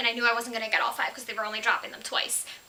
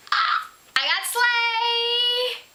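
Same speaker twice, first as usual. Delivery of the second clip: high, drawn-out singsong cheer; excited singsong; 13 semitones higher, 7 dB louder, much slower than usual. Real, not acted. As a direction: sing it out in delight. Excited unboxing of wanted book.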